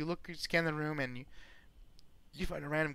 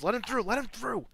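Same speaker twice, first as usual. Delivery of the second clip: guard calling out; 10 semitones higher, 5 dB louder than usual. Acted. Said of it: "tells the other guards".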